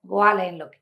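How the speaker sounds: ordinary delivery, nothing marked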